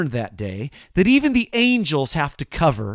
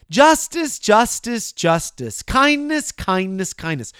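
each word first cried out high, then sinking; falling triple call; mock proclamation; 5 semitones higher, slower than usual. Shown as a demonstration. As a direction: repeat it like an angel's hymn. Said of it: "spoken like this".

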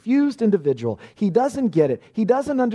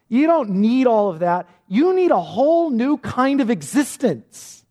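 rising sermon build. Acted. Pastor voicing Jesus telling Nicodemus, building to a ringing peak. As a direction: build the list to a climax.

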